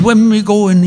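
put-on scheming Irishman, in an Irish accent. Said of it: "the Irish accent".

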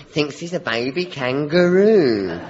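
normal talking voice

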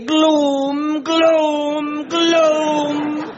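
gloom drawn out high and long, like a mournful wail; hammed-up wail of gloom; mock doom wail. Scots servant mocking the weather, mock doom wail.

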